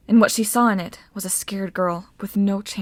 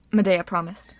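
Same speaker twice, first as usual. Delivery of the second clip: ordinary delivery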